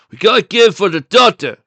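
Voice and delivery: high, loud henchman's line; stagey script reading. A narrator's reading. Biff reading from his papers, stagey script reading. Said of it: "he read".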